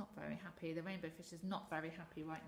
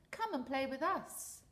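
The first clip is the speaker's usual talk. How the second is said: calling out; 7 semitones higher, 7 dB louder than usual. Acted. Fish friends calling out.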